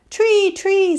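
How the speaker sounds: high, loud cries; chorus calling out. Acted. Dozen animals voting for tree, calling out. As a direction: call it out like an eager crowd.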